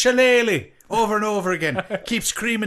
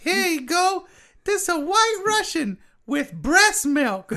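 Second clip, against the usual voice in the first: high, slowed-down bartender voice; mixologist character; 9 semitones higher than usual. Pretend. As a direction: play a drawling, high-pitched mixologist. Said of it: "call him a mixologist".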